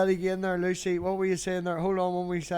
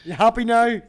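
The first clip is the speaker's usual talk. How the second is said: excited teasing; 6 semitones higher, 10 dB louder than usual. Real, not acted. Streamer gleefully teasing a friend he just kicked, loud and high. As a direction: crow over shrinking her off screen. Teasing while kicking from screen.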